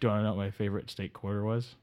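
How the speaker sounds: muttering dim guest; Delaware Dave. Acted. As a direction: drift in like a sleepy guest. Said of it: "Delaware Dave voice".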